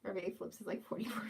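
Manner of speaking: quick breathy whisper, rushed; a fast hushed aside. Real, not acted. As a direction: rattle it off under your breath, fast. Quick aside muttered to chat.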